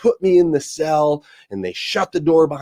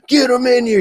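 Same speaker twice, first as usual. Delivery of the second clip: high, mock-frenzied yelling; imitated raging inmate; 11 semitones higher, 4 dB louder than usual. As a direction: yell it like a wild, caged man. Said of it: "this guy's just banging on the wall".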